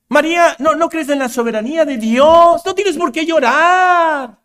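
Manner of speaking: high, loud, exasperated reprimand; mock scolding shout. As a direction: berate her impatiently, stretching the ends of phrases. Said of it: "no le gritó".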